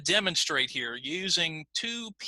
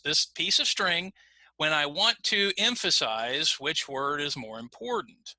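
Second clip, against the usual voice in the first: normal talking voice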